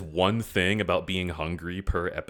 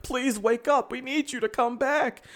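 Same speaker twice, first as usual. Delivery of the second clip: high, pleading mock voice. Friends mock-begging Aang to wake, high and pleading. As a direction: whine it up high like a ghostly plea.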